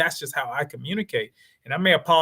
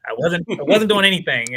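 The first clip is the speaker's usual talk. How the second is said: code switch voice; 5 dB louder than usual. Retold or imitated. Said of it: "we always talk about the code switch".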